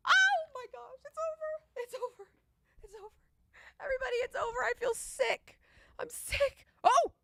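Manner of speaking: high-pitched crying out over a lost bet; wailing that it's over; anguished high wail. Real, not acted. Bettor wailing at the TV as the game slips away, high anguished cries. Losing a live bet, watching.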